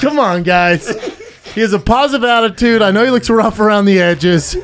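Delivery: loud, high call to a crowd; hammed-up rallying for a last pick; mock pleading pitch. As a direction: project it like pretend auctioning off a friend.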